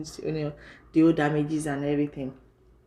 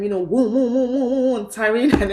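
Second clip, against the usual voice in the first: higher, louder woom woom like passing sirens; siren imitation; 6 semitones higher, 5 dB louder than usual. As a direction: mimic the emergency vans rushing by. Imitating emergency vehicle sirens.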